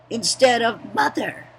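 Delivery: ordinary talk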